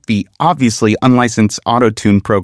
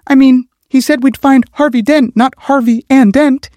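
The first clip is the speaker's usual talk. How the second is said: much higher and louder woman's voice, objecting; protesting female officer; 4 dB louder than usual. Acted. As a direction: push back hard as Ramirez, up high. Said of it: "she protested".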